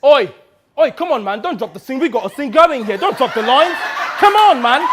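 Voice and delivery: high, loud, building scolding; irritated shout, rising to a peak; exasperated yelling. Acted. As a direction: yell at a scene partner who dropped the lines. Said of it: "it took Kayode yelling at me".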